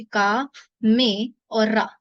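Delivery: slow, separated syllables; spelled out slowly. Shown as a demonstration. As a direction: spell out का मे रा one by one. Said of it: "इसको लिखते हैं ऐसे". (धीरे-धीरे अक्षर अलग करके)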